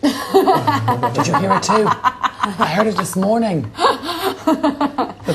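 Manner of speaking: high, slow words through laughter; stretched out, high-pitched laughing talk. Real, not acted. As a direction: giggle as you tell it. Laughing over an overheard shout.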